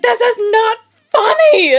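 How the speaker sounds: high, loud, drawn-out mock cry; indignant wail. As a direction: act out a distraught protest, stretched slowly. Upset retort to laughing mother-in-law.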